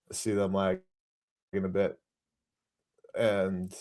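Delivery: laggy video call. Real, not acted. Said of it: "I was lagging a bit".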